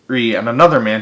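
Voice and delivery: usual speaking voice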